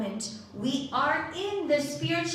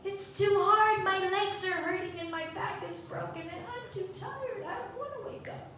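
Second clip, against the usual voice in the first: whiny excuse; 6 semitones higher than usual. Retold or imitated. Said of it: "But we say".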